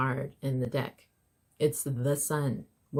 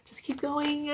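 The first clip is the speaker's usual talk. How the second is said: high breathy delight; giddy; 12 semitones higher than usual. Real, not acted. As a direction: gush it, thrilled. Giddy repeat of earlier line.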